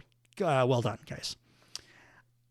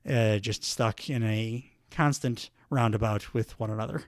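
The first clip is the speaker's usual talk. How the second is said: ordinary delivery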